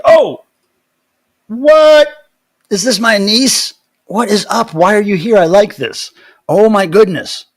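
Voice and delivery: high, loud burst of happy surprise; thrilled, astonished greeting; delighted surprise. Real, not acted. Spots niece in the stream chat.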